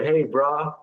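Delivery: light surfer-dude hey bra; mild mock dude greeting; casual slang greeting. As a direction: toss it off like greeting a buddy. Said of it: "That sounds like we might say".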